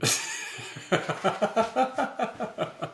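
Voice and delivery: long breathless laugh. Real, not acted. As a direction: crack up after ranting. Laughs after finishing the rant.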